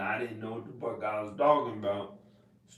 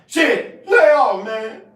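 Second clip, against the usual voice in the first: high, loud "lay off" yell; mock shout; 11 semitones higher, 16 dB louder, slower than usual. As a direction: yell it off like you're shoving someone back. Shouting someone off.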